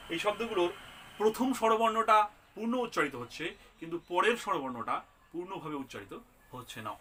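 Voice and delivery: normal talking voice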